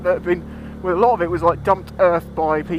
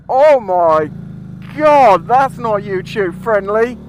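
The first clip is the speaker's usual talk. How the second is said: loud, slow horrified oh my God; disgusted shock; 2 semitones higher, 6 dB louder, much slower than usual. Real, not acted. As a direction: recoil in disgust. Finds a dead pheasant.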